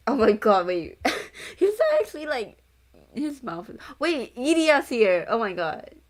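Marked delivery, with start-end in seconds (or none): 0.1-1.0 loud delighted gasp; excited. Real, not acted. Excited reading a character reveal. 1.3-5.2 high, loud excited squeal. Real, not acted. Excited reading a character reveal.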